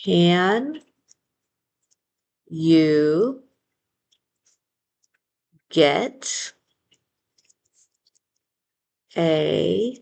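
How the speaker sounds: each word said alone with long pauses between. Shown as a demonstration. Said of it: "now we're gonna rewrite it".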